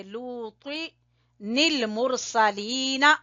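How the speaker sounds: लूती निल मुरसलीना chanted very slowly, with the noon linked onto निल; slow recitation. Shown as a demonstration. Teacher demonstrating the joined reading, slow recitation. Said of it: "त्वा, पुर पढ़ेंगे".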